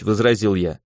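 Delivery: plain reading voice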